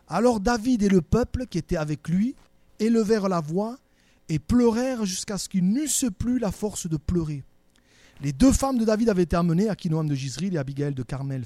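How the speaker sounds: loud, deliberate Bible reading to the congregation; scripture reading. Acted. Reads scripture aloud. (lecture solennelle et forte)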